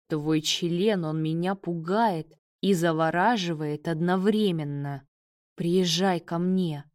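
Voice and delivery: ordinary delivery, nothing marked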